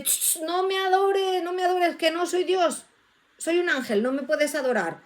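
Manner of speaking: high, hushing protest, easing down at the end; acted alarmed refusal. Angel stopping people who kneel to adore, acted alarmed refusal. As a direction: shush them and refuse in mock alarm.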